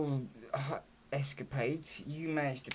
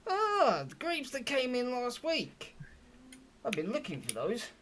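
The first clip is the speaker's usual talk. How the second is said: higher-pitched innkeeper in sudden recognition; chatty barkeep voice; friendly barkeep; 11 semitones higher than usual. Acted. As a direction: open with a big high "Ah". Game master plays the barkeep.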